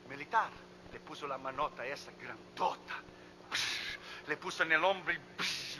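ordinary delivery

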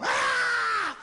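very high, strained mock shriek; hammed-up screeched curse from the gut; yelling from the stomach. Husband after the wedding cursing his wife, yelling from the stomach. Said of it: "sino del estómago".

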